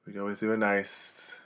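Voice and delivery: soft, hushed stage intro; low-key musical monologue; spoken song intro. Acted. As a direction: ease into the song, quiet and unhurried. Recites Proud Mary spoken intro.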